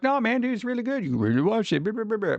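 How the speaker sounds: high, gushing dude voice, mildly mocking; mock fans. Mocking fans who keep recommending the show, high and gushing. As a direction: imitate pushy fans raving about it. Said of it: "people are like".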